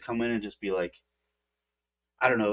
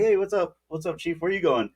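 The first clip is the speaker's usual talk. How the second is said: higher, overly casual hey; mock innocent greeting; 7 semitones higher than usual. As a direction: act innocent to the chief. Greeting chief, hiding party.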